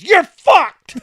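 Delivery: explosive high imitated shout; trooper losing it. Trooper hears driver has no container, exploding. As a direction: shout the verdict as a furious cop. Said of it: "Fucking loses it".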